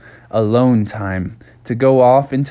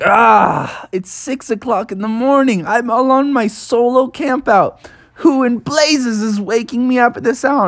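higher, exasperated protest; grumpy woken bear; 9 semitones higher than usual. Acted. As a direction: complain at being woken on your camp out. Bear woken early, complains.

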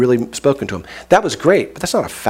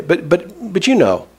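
ordinary talk

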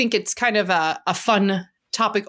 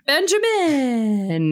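announcer voice; 5 semitones higher, much slower than usual. Acted. Drawn-out announcer intro.

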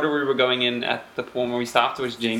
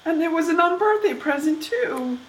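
mournful rabbit; 12 semitones higher than usual. Acted. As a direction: mourn the ruined watch.

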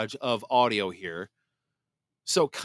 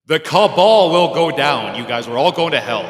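God voice; 9 dB louder than usual. Acted. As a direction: thunder it down like a deity. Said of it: "this is my God voice".

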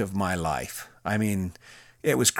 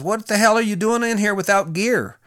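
high, raised mock yell of a lieutenant; imitated yell; 11 semitones higher, 4 dB louder than usual. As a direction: chew him out in a much higher pitch. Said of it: "he yells at me".

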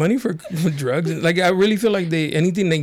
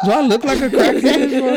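high, slow, laughing indignation; mock offended, laughing through it; offended disbelief; 9 semitones higher, 4 dB louder, slower than usual. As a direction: act insulted and amazed. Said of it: "I was like".

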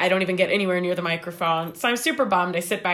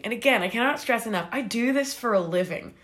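ordinary delivery

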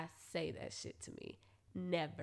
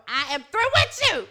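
emphatic dismissal; 6 semitones higher, 11 dB louder than usual. Retold or imitated. Dumping partner in retold scene.

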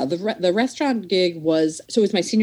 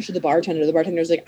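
ordinary delivery

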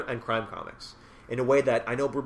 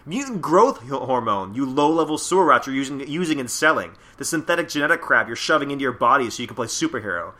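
angry agent tirade; 2 semitones higher, 7 dB louder than usual. Acted. Reading FBI agent berating Yakuza.

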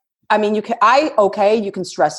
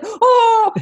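laughing mock shock; 13 semitones higher than usual. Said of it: "he sees the sticker price".

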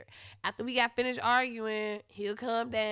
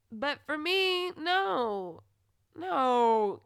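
emphatic no; 5 semitones higher, 5 dB louder, much slower than usual. Real, not acted. Rejecting his feelings, emphatic.

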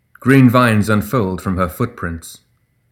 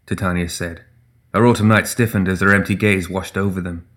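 usual speaking voice

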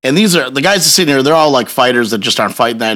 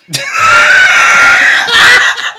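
squealing burst of laughter; loud high laugh; 5 dB louder than usual. Real, not acted. Comic laughing at his own punchline, uproarious.

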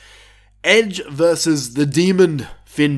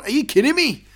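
excited disbelief; 11 semitones higher, faster than usual. Real, not acted. Thrilled disbelief.

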